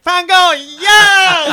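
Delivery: slow, high, loud wailing cry; Talia Shire wail. Retold or imitated. Parodying a classmate's weepy Godfather scene with a high, slow wail. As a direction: stretch it out as a melodramatic sob. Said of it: "like Talia Shire in The Godfather".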